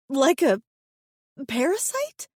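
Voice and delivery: high, choked disgust; choked horrified. A narrator's reading. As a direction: gag out the question. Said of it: "a choked, horrified".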